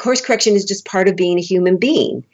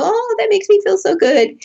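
very high, swooning delight; hammed-up pleased sigh of a line; mock gushing. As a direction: gush in an exaggerated high voice. Acting her scripted delighted response.

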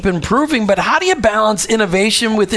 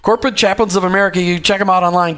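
usual speaking voice

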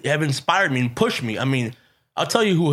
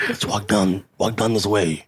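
imitating Olmos; 6 semitones lower than usual. Retelling Olmos's words.

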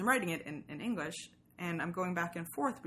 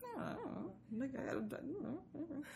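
high, soft, offhand shrug of a voice; mock a shrug with a much higher, quieter voice; breezy, hushed noncommittal mock; shrugging indifference; 9 semitones higher, 9 dB quieter than usual. Imitating indifferent Swedish romance.

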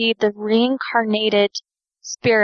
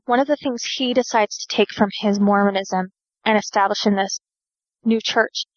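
ordinary delivery

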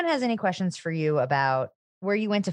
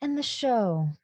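soft, soothing therapist tone; calm counselor gently reassuring; 3 semitones higher, 5 dB quieter, slower than usual. Pretend. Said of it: "That's my therapist voice".

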